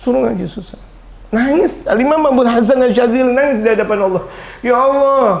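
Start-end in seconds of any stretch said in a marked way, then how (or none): 1.3-5.3 higher and louder cry to God; loud lament. Acted. Preacher crying out the imam's plea to God.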